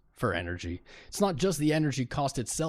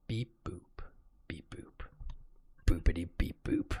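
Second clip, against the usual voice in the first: whispered beep boop; soft robotic beeps; 6 semitones lower, 7 dB quieter than usual. Pretend. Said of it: "I'm a bot".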